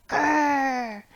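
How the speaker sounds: light playful grr grr; bear grr. Acted. Teacher growling along in the song, mock grr. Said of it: "you're gonna go, Grr".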